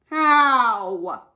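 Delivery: loud, drawn-out snarl; one long snarled demand. A narrator's reading. Lion snarling at rabbit's advice. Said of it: "snarled the lion".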